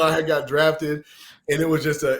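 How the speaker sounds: ordinary talk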